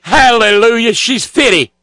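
loud, high hammed-up praise shout; mock revival hallelujah. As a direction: cry it out like a revival congregation. Mock church praise exclamations.

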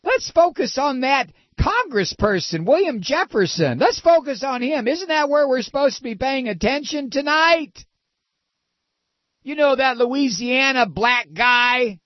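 high, whiny hammed-up indignation; high-pitched, insinuating mock protest; mock deflecting pundit. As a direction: parody an outraged apologist changing the subject. Parodies right-wing pundits deflecting.

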